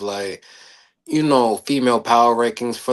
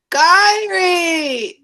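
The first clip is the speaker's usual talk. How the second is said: high, loud squealed name; sudden falsetto cry of the name; name call; 6 dB louder than usual. Retold or imitated. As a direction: shout the name like her entrance cry. Announcing wrestler name.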